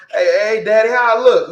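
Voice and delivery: a touch of a show-off asking daddy how he looks; mock swagger voice. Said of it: "what he say on next Friday".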